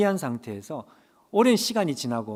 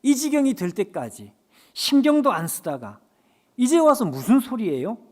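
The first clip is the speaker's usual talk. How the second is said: rising, louder accusing protest; resentful protest; 6 semitones higher, 6 dB louder than usual. Retold or imitated. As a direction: build into an indignant outburst. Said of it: "그런 말이 나왔습니다". (점점 높아지는 원망 섞인 항의)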